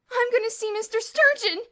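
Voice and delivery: furious high yell; raging. Acted. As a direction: rage at them. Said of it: "Elmer raged".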